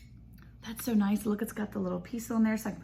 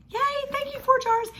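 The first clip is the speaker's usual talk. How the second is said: high, sung-out thank you; sing-song thanks. Real, not acted. Thanking the sponsor Four Jars on camera, high sing-song. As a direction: trill it like a cheerful jingle.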